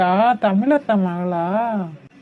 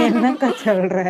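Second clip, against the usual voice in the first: laughing disbelief. Acted. Friend reacts to wife's absurd marital story, laughing. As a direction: question broken up by laughter.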